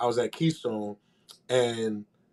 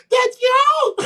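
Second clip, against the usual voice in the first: high loud yell; retold delighted yell; excited shout; 7 dB louder than usual. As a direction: shout it in glee. Girls at door, shouting.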